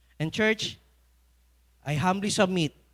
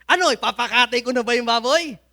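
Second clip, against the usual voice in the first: excited father; 5 semitones higher, 8 dB louder than usual. Retold or imitated. Excited father in retold story.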